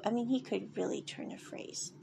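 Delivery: soft unvoiced whisper; whispered aside. Real, not acted. Admiring aside to the audience.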